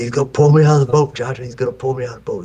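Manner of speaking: put-on a scared kid pleading with grandpa, rushing; panicked child. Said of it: "terrified that this dang fish".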